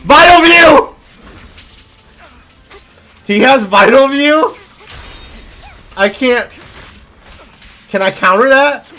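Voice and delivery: loud, high, panicked gamer shouts; yelling in disbelief mid-fight; excited yelling. Real, not acted. Boss fight, frantic reactions.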